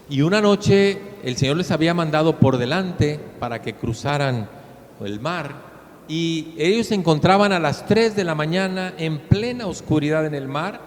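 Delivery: ordinary talk, nothing marked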